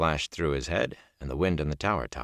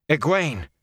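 high, louder cry of the name; alarmed outcry; 9 semitones higher, 5 dB louder than usual. A narrator's reading.

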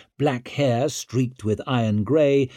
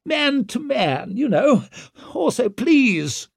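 high, stretched wheedling; shrill, desperate begging; increasingly shrill; 14 semitones higher, 4 dB louder, slower than usual. A narrator's reading. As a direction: plead shrilly for a private word. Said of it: "voice was growing increasingly shrill".

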